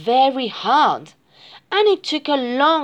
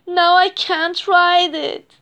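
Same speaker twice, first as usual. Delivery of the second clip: higher-pitched mild whine; sniffling upset bunny; 7 semitones higher, 4 dB louder than usual. A narrator's reading. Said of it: "Bonnie sniffed".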